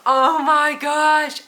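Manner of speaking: high, loud, slow gush; squealing with delight; giddy squeal. Real, not acted. Giddy reaction.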